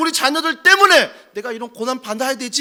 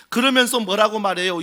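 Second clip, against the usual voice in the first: ordinary delivery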